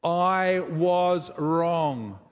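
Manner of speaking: "I was wrong" stretched out very slowly, each word weighed; slow practice admission. Shown as a demonstration. Pastor practising an admission with the congregation, very slow. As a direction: say it like it costs you something. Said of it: "What about".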